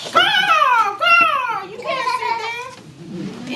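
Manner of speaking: a crow squawking at Carl. Acted. Quoted voice in read story.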